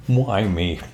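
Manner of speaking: ordinary talk, nothing marked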